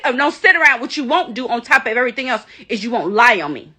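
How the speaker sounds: ordinary talk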